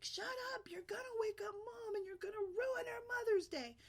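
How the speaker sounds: put-on high-pitched frantic boy's yell; shouting kid. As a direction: scream it to hush the others. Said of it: "as loud as he could".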